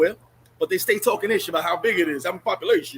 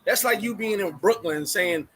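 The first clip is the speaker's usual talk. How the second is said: usual speaking voice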